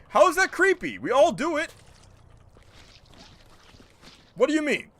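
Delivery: high-pitched real indignation; incredulous, defensive objection; indignant protest. Real, not acted. Protests at being called creepy.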